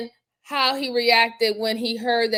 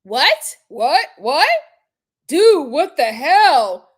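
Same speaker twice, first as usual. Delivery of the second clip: loud, rising mimicked whats, then a drawn-out demand; shocked disbelief; 7 dB louder, slower than usual. Commentator mimicking the husband's reaction, shocked disbelief. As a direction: act out a stunned, indignant reaction.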